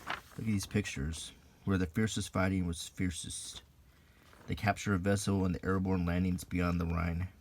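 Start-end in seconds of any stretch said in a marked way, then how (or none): none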